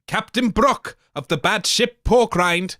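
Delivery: ordinary delivery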